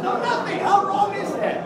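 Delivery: high, loud comic indignation; mock outrage. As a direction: protest the injustice loudly. Mock outrage at hospital bill.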